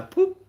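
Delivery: very high, short "boop"; imitated scanner beep. Speaker showing how a chip gets scanned, imitated beep. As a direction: mimic an electronic scanner with a tiny high blip.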